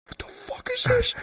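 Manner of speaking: very high baffled cry; shocked squeak. Retold or imitated. Tiny player in someone's palm, high baffled squeak. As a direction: squeak it in disbelief.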